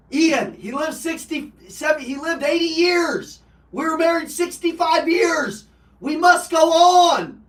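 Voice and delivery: high, loud, slow retold yell; grandma yelling. Grandma yelling at her grandson to go on. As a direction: hammer each word home like a grieving grandma. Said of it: "She's yelling at me".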